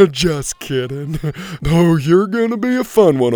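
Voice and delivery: loud teasing laugh into words; laughing goat. Acted. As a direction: giggle at the prank. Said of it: "as he laughed".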